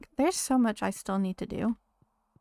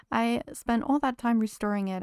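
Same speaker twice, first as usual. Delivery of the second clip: ordinary talk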